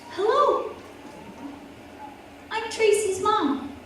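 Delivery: cheery mom greeting. Retold or imitated. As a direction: chirp it out like a brave, cheerful mom. Mom greets principal brightly.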